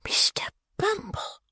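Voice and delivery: shocked high whisper. Acted. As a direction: gasp it out in a whisper. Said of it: "cried that discreet lady in a whisper".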